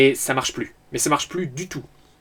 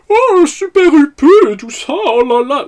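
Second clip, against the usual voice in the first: put-on high, loud, snooty Versailles accent; posh Versailles accent; 11 semitones higher, 7 dB louder than usual. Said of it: "l'accent, euh, versaillais consanguin".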